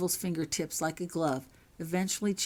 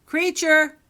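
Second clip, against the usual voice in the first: much higher, much louder call; shout; 10 semitones higher, 12 dB louder than usual. A narrator's reading. Harry calling the elf Creature to appear, loud shout. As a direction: call the elf across the castle. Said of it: "he shouted".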